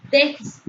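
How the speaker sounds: déficit with DÉ punched high and loud, rest dropping away; stress on first syllable. Shown as a demonstration. Teacher showing an esdrújula word, déficit stressed on DÉ. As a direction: hit DÉ hard in déficit. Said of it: "una mayor intensidad de pronunciación".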